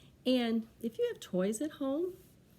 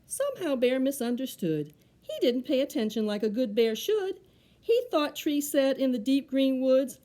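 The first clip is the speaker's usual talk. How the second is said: lively, projected picture-book reading; children's story narration; 4 semitones higher, 9 dB louder than usual. A narrator's reading. Reader tells children how Bear misunderstood, story narration. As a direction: read it out clearly with bouncy rhyme.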